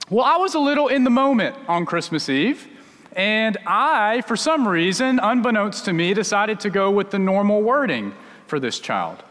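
usual speaking voice

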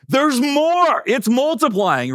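high-pitched mock panic; freaking out. Prank victim still finding shampoo in his hair, mock freak-out. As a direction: voice leaping up in comic alarm. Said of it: "he just starts freaking out".

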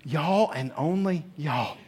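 slow, softened, weighty repeat; deliberate emphasis. Retold or imitated. Preacher restating Jesus' words to the congregation, slowly and softly. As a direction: drop the volume and spell out who is meant.

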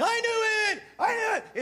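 high, excited chant; gleeful cheer. Retold or imitated. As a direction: cheer it like a kid who was right. Disciples singing with joy.

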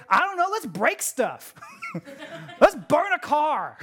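high, giddy mob member; clueless troublemaker, laughing between ideas; gleeful rioter. Acted. Rioter answering why they riot, giddy and clueless. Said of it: "they're like".

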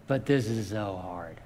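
half-joking whine about the chore; mock whine. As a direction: sulk it like a tired kid. Whining to God about reading.

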